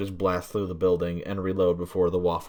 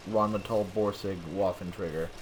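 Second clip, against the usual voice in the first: usual speaking voice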